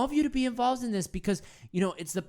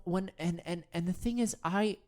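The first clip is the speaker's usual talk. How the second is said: ordinary delivery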